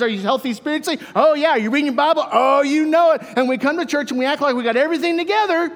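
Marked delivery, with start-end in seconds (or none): 0.0-3.2 higher, bright put-on pew answers with the oh yeahs pushed louder; mock fake cheer. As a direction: play the eager churchgoer pretending all is well. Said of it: "everybody shows the part".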